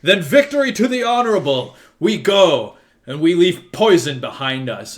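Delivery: loud, high, bitter parting shout; angry shout. Acted. Praetor shouting in anger at the prince as he leaves. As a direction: spit the last words as he rides off. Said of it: "he shouts".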